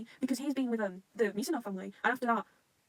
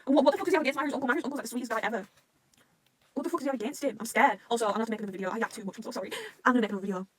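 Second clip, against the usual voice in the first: ordinary talk, nothing marked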